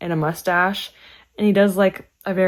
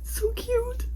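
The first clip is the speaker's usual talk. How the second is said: much higher, softer, half-whispered gushing; cute gushing; 8 semitones higher, 4 dB quieter, slower than usual. Real, not acted. Fan gushing over a friend's handmade bookmark, high and soft. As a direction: melt over it, airy and high.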